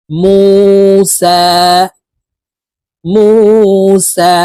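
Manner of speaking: মুসা with the মু held long and slow; one-alif stretch. Shown as a demonstration. Quran teacher stretching মুসা for students to repeat. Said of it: "এক আলিফ টেনে পড়ব".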